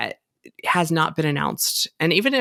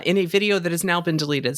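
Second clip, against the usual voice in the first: normal talking voice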